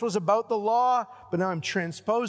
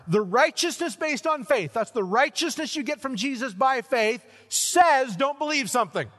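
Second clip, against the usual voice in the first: loud and higher, preaching with force; preaching at full force; 7 semitones higher, 4 dB louder than usual. Real, not acted. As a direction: drive the point home from the pulpit.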